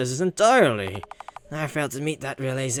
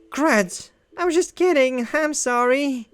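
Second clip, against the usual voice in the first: high, hurried sorry; high-pitched nervous apology; flustered apology; 8 semitones higher than usual. Acted. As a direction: backpedal in a panic.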